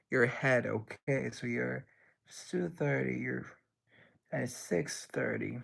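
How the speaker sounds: normal talking voice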